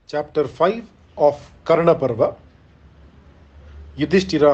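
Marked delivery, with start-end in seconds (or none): none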